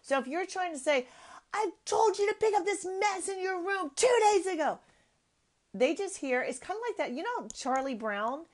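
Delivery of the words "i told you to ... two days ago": high-pitched acted scolding yell; demonstrated yelling. Mother yelling at child about a messy room, acted high scream. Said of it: "People hear the scream".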